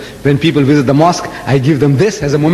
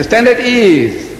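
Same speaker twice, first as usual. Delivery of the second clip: drill command; 7 semitones higher, much slower than usual. Retold or imitated.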